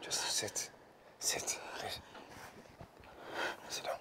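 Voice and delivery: very soft, half-whispered coaxing; quiet, breath-light reassurance; hushed soothing. Acted. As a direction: gently coax them to settle. Husband soothing his frightened wife.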